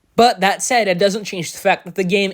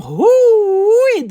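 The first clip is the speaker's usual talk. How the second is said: excited whoo; 14 semitones higher, 5 dB louder than usual. Real, not acted. Exclaiming at the Bratz license.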